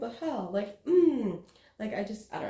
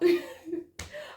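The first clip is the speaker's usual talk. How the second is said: 1 high incredulous laugh. Real, not acted. Laugh inside incredulous quote.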